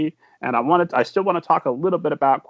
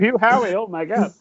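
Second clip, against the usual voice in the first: higher-pitched fangirl imitation; playful, lightly hammed-up adoration; mock swooning fan; 5 semitones higher than usual. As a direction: gush teasingly like a starstruck fan. Mimics fans throwing underwear at him.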